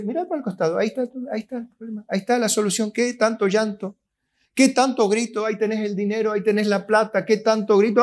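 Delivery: a hint of God patiently pointing the way; God's voice. The preacher voices God telling complainers to look aside, lightly chiding. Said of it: "Y Dios dice".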